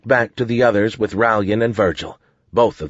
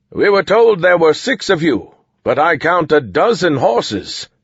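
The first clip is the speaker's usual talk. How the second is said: higher, slowing suspicious soldier; 7 semitones higher, 4 dB louder than usual. A narrator's reading. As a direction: voice a wary soldier counting horses.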